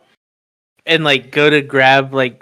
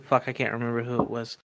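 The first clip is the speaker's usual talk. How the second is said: usual speaking voice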